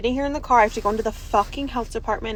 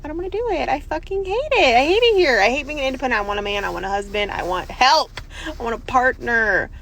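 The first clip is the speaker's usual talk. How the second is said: frustrated outburst; 3 semitones higher, 6 dB louder, faster than usual. Real, not acted. Single mom ranting to her phone about doing it all alone, getting louder and angrier. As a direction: let the frustration build into yelling.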